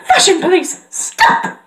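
high mock-police order; fashion pony impression. Fashion pony as fashion police, dramatic high command. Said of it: "next is Rhodey".

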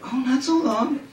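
high, flirty woman's voice with a laugh; sultry woman. Acted. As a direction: purr it like a seductive woman. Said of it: "voice of a woman".

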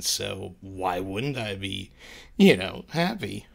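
ordinary talk, nothing marked

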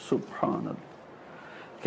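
whispered, slow exclamation of praise; awed whisper. Real, not acted. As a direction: breathe it out slowly, barely voiced. Awed aside after the verse.

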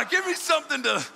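high, loud hammed-up fiery preacher; intense Corey Russell. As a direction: do a revved-up mock preacher roar. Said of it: "he's intense".

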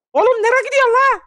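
angry yell. Acted. Farmer shouting at the helper who boarded the wrong tractor, angry yell. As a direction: bark it out in disbelief.

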